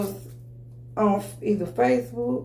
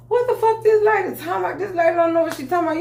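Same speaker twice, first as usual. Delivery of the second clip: much higher, fast, indignant disbelief; caught an attitude; 9 semitones higher, 3 dB louder, much faster than usual. Retold or imitated. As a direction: fire it off, outraged and incredulous. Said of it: "I kinda caught an attitude".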